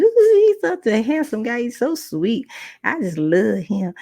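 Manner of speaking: mock swoon over a handsome guy; adoring mother. Mother gushing to her daughter about the handsome visitor, adoring. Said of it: "my mother loved it".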